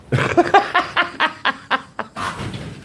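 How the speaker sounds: high scornful cackle; mocking laugh. Real, not acted.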